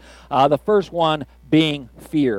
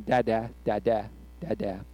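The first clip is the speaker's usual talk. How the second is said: imitating a baby; 6 semitones lower, 10 dB quieter than usual. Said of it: "his favorite word and his first word".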